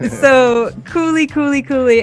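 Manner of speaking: normal talking voice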